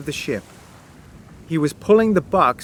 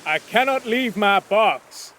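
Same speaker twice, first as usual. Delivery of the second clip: shouted refusal; 6 semitones higher than usual. A narrator's reading. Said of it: "Cornelius shouted again".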